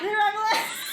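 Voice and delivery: laughing through the words; incredulous, cracking up; laughing disbelief. Real, not acted. Laughing disbelief at reviving witch.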